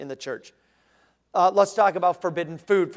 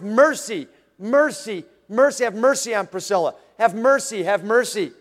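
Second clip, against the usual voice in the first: loud, raised cries for mercy; Jesus' blood crying out before the Father, raised and loud; loud pleading; 2 semitones higher, 4 dB louder than usual. Acted. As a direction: plead for her loudly before the throne.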